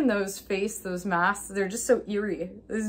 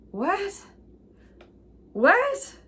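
acted disbelief; 10 semitones higher than usual. Viewer reacting to the movie's ending, acted disbelief. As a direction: squeak it out in mock bafflement, building.

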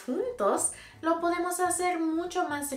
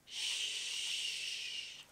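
soft whispered shh; shush; 12 dB quieter than usual. Shown as a demonstration. Teacher shows the kids how to hush, whispered. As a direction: hush them gently. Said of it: "Hacemos esto cuando queremos estar callados".

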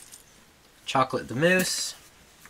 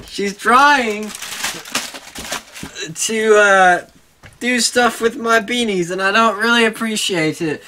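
mock complaint; 6 semitones higher, 4 dB louder than usual. Real, not acted. Mock complaint about dog.

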